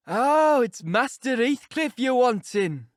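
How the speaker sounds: put-on a surly old servant catching on at last; old Yorkshire servant. Old servant Joseph answering the visitor, surly Yorkshire old man.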